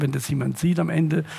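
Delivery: ordinary delivery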